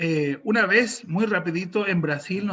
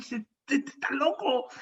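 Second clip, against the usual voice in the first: disbelieving mutter; 7 semitones higher, 4 dB quieter than usual. Retold or imitated. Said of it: "me quedó mirando como diciendo".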